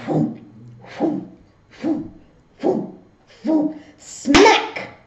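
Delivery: breathy whooshing noises rising to a smack; spoon whoosh sounds. Retold or imitated. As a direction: mimic a spoon flying through the air, then the hit. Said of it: "It was like".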